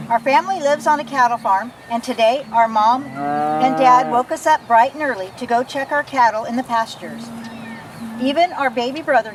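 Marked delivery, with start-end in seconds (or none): none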